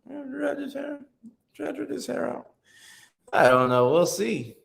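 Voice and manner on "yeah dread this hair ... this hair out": squeaky high falsetto, softer; high soft teasing falsetto; high mock voice. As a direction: play it up in a silly high voice. Mock voice repeating viewer's dread comment.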